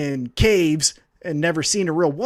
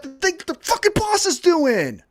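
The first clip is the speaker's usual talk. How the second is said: angry rant; 10 semitones higher than usual. Real, not acted. Ranting at wrestlers.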